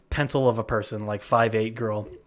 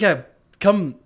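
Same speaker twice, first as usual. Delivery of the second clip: louder, higher mock call for help; raised voice, as if beckoning across a room; retold call-over; 8 semitones higher, 7 dB louder than usual. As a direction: act out calling someone over. Retold calling the guy over.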